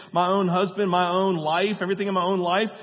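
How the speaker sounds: ordinary delivery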